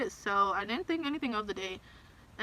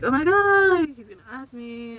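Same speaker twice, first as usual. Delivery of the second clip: excited squeal; 5 semitones higher, 8 dB louder, slower than usual. Retold or imitated. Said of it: "So I was like".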